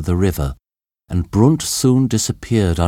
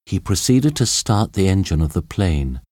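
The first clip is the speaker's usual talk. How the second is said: plain reading voice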